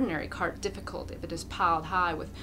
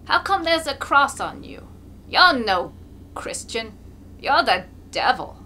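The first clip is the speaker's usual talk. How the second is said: put-on higher, louder outraged old man; indignant shout; 5 semitones higher, 9 dB louder than usual. A narrator's reading. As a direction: denounce him with outrage. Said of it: "shouts indignantly".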